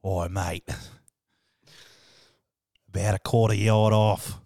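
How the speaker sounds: hammed-up Australian wildlife presenter; Steve Irwin. Host describes friend as wildlife, Australian accent. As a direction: put on a broad Australian accent, spotting an animal. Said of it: "How would Steve Irwin describe".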